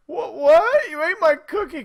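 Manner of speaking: high, loud shocked disbelief; shocked little sister. Retold or imitated. Charlie learns her sister ate her cookie, high shocked. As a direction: gasp out the betrayal. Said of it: "the look on Charlie's face was like".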